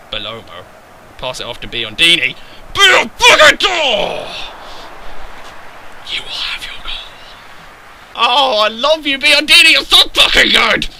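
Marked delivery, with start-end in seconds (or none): 2.7-4.3 drawn-out high, loud yell; excited scream. Real, not acted. Screaming at a shot. 6.1-7.1 hushed, voiceless promise; whisper. Real, not acted. Whispered promise to his player. 8.1-10.9 high, loud gushing praise; shower the player with loud love; excited adoration. Real, not acted. Gushing praise after goal.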